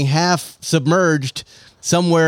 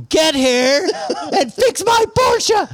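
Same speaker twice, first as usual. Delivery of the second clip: demanding shout; much slower than usual. Pretend. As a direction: drag it out then yell the end. Demanding boss voice.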